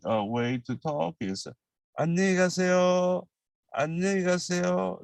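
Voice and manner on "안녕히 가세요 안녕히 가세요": much higher, quick and cheerful; bright friendly goodbye. Shown as a demonstration. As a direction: sing it out to a close friend. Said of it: "very, very friendly".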